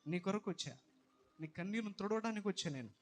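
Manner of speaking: loud, fervent sermon as God's voice; preaching at full force. Acted. Preacher voicing God to the grieving mother, loud and fervent. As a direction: thunder it out to the congregation.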